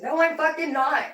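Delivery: high, heated mock outburst; retold angry protest. Daughter denying her mom's claim, retold in heated protest. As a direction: act out a defensive snap back, pitched well up.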